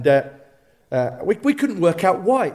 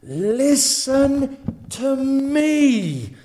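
very slow, drawn-out call rising to a half-sung end; God calling; 4 semitones higher, much slower than usual. Acted. God speaking to the congregation, slow sung-out call. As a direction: draw each word out like a tender call. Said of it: "he's saying".

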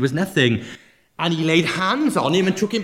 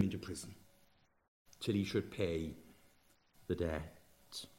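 whispered; 10 semitones lower, 19 dB quieter than usual. Real, not acted. End of the harsh sentence.

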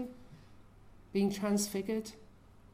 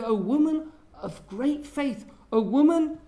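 slow, much louder declaration of praise; loud proclaiming; 4 semitones higher, 8 dB louder than usual. Acted. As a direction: ring it out over the crowd: great is your faith. Jesus praises the believing woman.

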